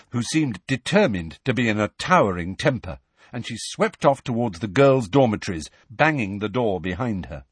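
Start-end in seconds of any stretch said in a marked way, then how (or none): none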